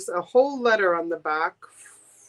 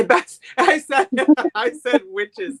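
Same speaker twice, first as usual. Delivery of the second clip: laughing while admitting the slip; 5 semitones higher, 5 dB louder than usual. Real, not acted. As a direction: giggles through the words. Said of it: "Be careful how you say that".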